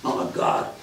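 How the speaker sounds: usual speaking voice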